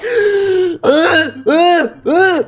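high startled gasp; shrill gasp. Real, not acted. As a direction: gasp sharply in shock. Pushed off the edge.

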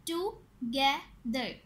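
"Together" slowly in three separate beats; "together" counted out in three syllables. Shown as a demonstration. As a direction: say "together" as टु, गे, दर with pauses. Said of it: "इनके three syllables हैं".

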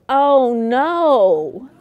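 a loud mock cry of disappointment; grandma's loud mock dismay at news of another girl. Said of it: "my mother's response was".

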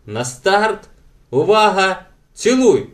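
loud, high starter's call; commanding. A narrator's reading. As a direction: call a race start, rising to the last word. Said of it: "скомандував Дак".

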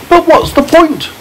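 put-on a child's curious question as James; James asking. A narrator's reading. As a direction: lift the voice up for the boy. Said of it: "said James".

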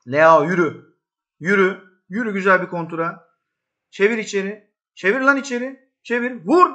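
high, loud shouts at the screen; real match excitement, yelling; excited urging. Real, not acted. Urging a counterattack live.

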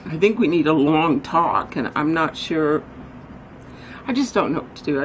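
ordinary delivery

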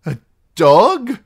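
high, loud puzzled question; bewildered. A narrator's reading. Captive baffled by the outlaw's question, bewildered. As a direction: ask it utterly lost. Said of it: "Merritt was lost".

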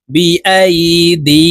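"দি" stretched on a steady long vowel; measured "বি আই", then lengthen "দি"; one-alif madd. Shown as a demonstration. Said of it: "এক আলিফ টেনে পড়তে হবে". ("দি" এক আলিফ টেনে পড়া)

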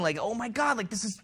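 hushed retold amazement; awed disbelief. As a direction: act out stunned delight. Retold awestruck realization.

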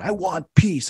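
high-pitched blurted answer; retold outburst. Demon answering the exorcist's demand, retold outburst. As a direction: let it burst out high.

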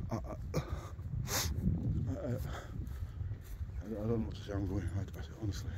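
stammering, voiceless and slow with shock; words stuck in a frightened throat; real fright. Real, not acted. Filming in the dark woods, stammering in real fear. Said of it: "I'm freaking out a bit now".

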